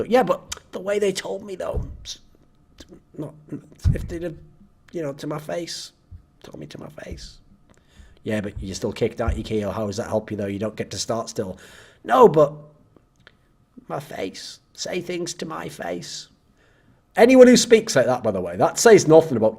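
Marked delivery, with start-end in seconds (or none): 0.0-5.9 whiny mumbling complaint. Pretend. Whiny player insists on face. 6.4-7.4 whispered sulk. Pretend. Whiny player trails into whisper. 8.2-11.6 dry reasonable voice. Pretend. Reasonable voice answers. 12.0-16.3 sulky whine. Pretend. Whiny player repeats demand.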